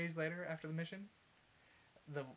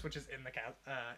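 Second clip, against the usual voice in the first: ordinary talk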